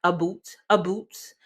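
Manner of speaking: "a boot" said as Canadians say about, like boot. Shown as a demonstration. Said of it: "different how they say about".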